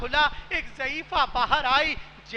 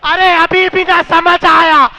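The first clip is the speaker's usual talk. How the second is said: loud, rising preaching that wails the story; impassioned majlis lament; 12 dB louder than usual. Acted. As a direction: drive the mourners to tears with full-force lament.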